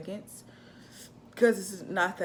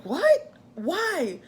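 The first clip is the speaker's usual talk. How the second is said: high, loud startled cry; shocked disbelief; 10 semitones higher, 5 dB louder than usual. Retold or imitated. Wife hearing she must still push, shocked. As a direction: blurt it out in shock. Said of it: "I'm like".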